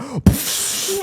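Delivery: high burst of spark noise; imitated sparks. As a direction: mimic an electrical flash with the mouth. Imitating sparks flying from the cable.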